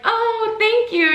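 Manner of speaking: very high, sweet polite thanks; being nice. Retold or imitated. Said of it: "being nice".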